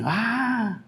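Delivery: usual speaking voice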